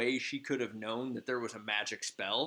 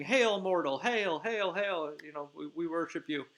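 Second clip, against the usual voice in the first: high, louder chant of hail that fades into the last line; hailing fairy servants; 6 semitones higher, 3 dB louder than usual. Retold or imitated. Fairy servants hailing Bottom in high chanting voices. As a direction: proclaim it up high like adoring little fairies. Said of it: "all just start saying".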